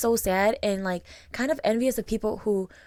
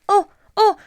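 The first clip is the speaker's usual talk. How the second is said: high, loud, awkward fluster; hammed-up uneasy high voice; imitated weird reaction; 12 semitones higher, 12 dB louder than usual. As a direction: imitate a mom getting weird about tears. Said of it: "like, really weird".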